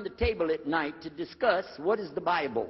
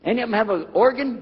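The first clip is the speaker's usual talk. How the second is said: loud pressing question; 7 semitones higher, 10 dB louder than usual. Retold or imitated. Pressing the point louder.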